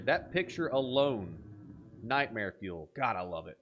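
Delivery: ordinary delivery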